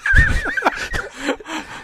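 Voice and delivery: high mock giggle; giddy high laugh. Imitating the clerk, high giddy laugh.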